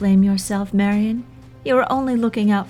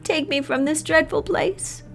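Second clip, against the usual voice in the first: much higher, fragile begging; tearful plea; 9 semitones higher than usual. A narrator's reading. Laura begging in tears.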